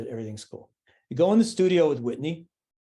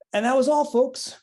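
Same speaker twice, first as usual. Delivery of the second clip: 1 cartoon sign-off; 10 semitones higher, 5 dB louder than usual. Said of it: "cartoon sign-off".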